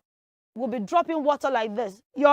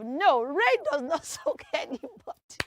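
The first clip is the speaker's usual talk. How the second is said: ordinary talk, nothing marked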